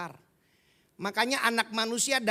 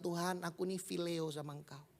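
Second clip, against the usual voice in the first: soft humble reply; 5 semitones lower, 9 dB quieter than usual. Acted. Peter's second reply to Jesus.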